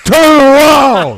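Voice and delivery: high, loud, slow exasperated yell; yelled turn around. Retold or imitated. Comic tells fellow passengers to face forward, loud and high. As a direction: shout it like you can't stand it. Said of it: "I'm looking straight ahead going".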